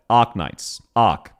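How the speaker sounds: "Arknights" and "Arc" with the R barely there; Aussie Arknights. Shown as a demonstration. Streamer teaching the game name, "Arc" with a dropped R. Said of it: "The R is barely there".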